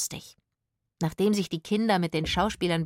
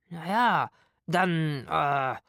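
drawn-out, hesitant words dropping lower; unsure; 3 semitones lower, much slower than usual. A narrator's reading. Itzig not knowing what to do next, unsure. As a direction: stall while searching for words.

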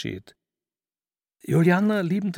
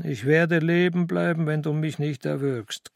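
plain reading voice

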